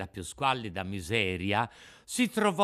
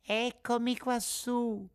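high, slow little pipe; tiny voice; 11 semitones higher, slower than usual. Acted. Cricket reveals itself from the rafters, piping. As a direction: call down in a thin small voice. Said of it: "la solita vocina".

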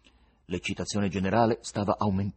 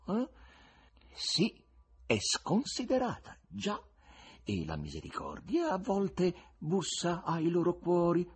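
pensive musing; 9 semitones higher than usual. A narrator's reading. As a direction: muse aloud, lingering over phrases. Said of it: "rispose quello pensieroso".

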